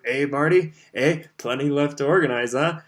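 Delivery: hint at a secret; sly hinting. Acted. Bagman hinting slyly.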